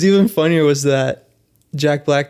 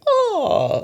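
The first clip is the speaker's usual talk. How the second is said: high eagle cry. Retold or imitated. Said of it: "he does, like, some kinda".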